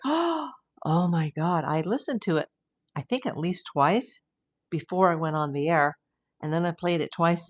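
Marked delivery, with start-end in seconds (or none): 0.0-1.6 shocked gasp. Retold or imitated.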